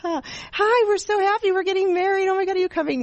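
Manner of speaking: high, gushing, over-the-moon bride voice. Retold or imitated. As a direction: play a giddy bride bragging about her wedding. Mock bride gushing about wedding.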